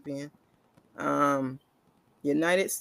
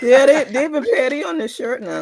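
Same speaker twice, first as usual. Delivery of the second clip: high, loud laughing complaint; cracking up at the petty shirt; laughing loudly at how petty the shirt is; 8 semitones higher, 10 dB louder than usual. Real, not acted.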